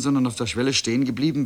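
ordinary delivery

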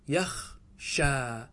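"يخشى" split slowly, long open "sha"; open shin shown. Shown as a demonstration. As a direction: pull "يخشى" apart to show the fatha on the shin. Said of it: "انظروا الشين مفتوحة". (نطق «يخشى» ببطء مع إبراز فتحة الشين)

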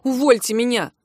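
mildly imploring cry; understated desperate plea; pleading. A narrator's reading. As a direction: beg to be let go. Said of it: "взмолился".

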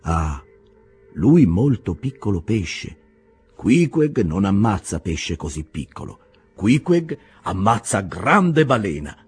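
slow, weighty broken Italian; proud harpooner looking down on a tiny fish; disdainful Queequeg. Acted. Queequeg dismisses the bumpkin with slow disdain. Queequeg scorns the small man.

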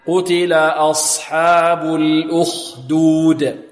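slow, drawn-out chanted "أصحاب" with unrounded ص; recitation without rounding. Shown as a demonstration. Teacher modelling the verse, slow chant of "أصحاب" without lip rounding. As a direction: chant "قتل أصحاب الأخدود" slowly, heavy ص and خ. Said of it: "فبدون ضم الشفتين".

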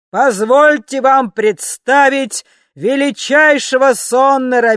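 booming slow announcer, high and loud; thunderous voice. Acted. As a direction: boom it out like a ringmaster. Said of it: "громовой голос". (громовой голос конферансье)

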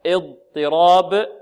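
اضطراب slow and loud; slow stressed word. Shown as a demonstration. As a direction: lean on اضطراب. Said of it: "وقلنا القلقلة".

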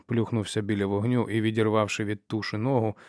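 plain reading voice

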